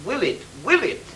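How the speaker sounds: "Will it?" said as a real question, stress on "Will"; questioning tag. Shown as a demonstration. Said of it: "You say, "Will it?" It's a question".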